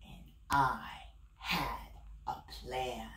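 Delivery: low hushed whisper of a plotting wolf; sly whisper. Acted. Wolf whispering his secret plan to the listener.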